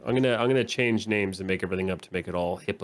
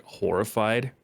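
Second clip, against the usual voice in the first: ordinary talk, nothing marked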